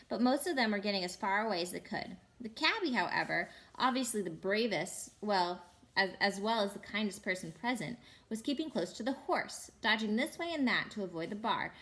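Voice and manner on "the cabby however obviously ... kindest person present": plain reading voice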